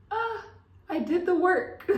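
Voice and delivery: high, loud, laughing through tears of relief; emotional laugh. Real, not acted. Telling her audience she did the work, laughing emotionally. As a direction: laugh it out, choked with pride. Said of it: "Ooh, I'm about to get emotional".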